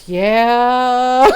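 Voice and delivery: imitated resigned sigh; sighed agreement. Brother concedes grandmother won't apologize, sighed resignation. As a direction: let the word out on a long sigh. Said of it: "my brother sighs and he goes".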